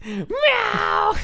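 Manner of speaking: high shouted meow; cat noise. Retold or imitated. Player acting out meowing in awkward silence, cat noise. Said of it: "I'll make a cat noise".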